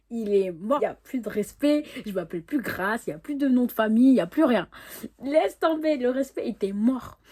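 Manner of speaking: loud, mounting indignant rant; acted outrage. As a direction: build to a high, loud declaration, half playing it up. Indignation rejouée devant le copain. (tirade indignée, de plus en plus forte)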